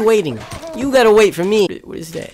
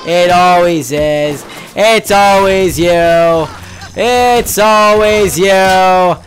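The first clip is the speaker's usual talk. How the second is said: drawn out, loud and bitter; slow angry rant; 9 dB louder, much slower than usual. Real, not acted. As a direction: spit each word slowly. Slow building rant at player.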